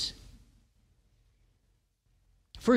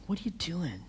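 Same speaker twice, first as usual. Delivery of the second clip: soft, hushed God asking; the still small voice, barely above a whisper; gentle whisper; 5 semitones lower, 15 dB quieter than usual. Acted. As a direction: breathe it gently, nothing hard. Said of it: "Came a gentle whisper".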